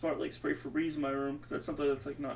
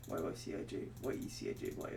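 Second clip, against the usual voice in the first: softer whisper, letter by letter; hushed, concentrating whisper; whispered spelling; 11 semitones lower, 10 dB quieter, faster than usual. Real, not acted. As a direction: murmur the letters to yourself. Repeating letters, trailing to whisper.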